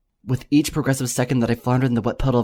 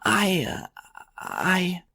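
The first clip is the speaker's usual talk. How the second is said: lightly voiced, higher stammer; stammering mare; 7 semitones higher, much slower than usual. A narrator's reading. Startled mare stammers at the yelling unicorn, lightly voiced. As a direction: trail off, lost for words. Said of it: "a stammering face".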